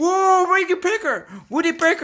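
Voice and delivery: high gasping surprise; loud slapstick yelps. Pretend. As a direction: yelp in amazement. Gasps at woodpecker.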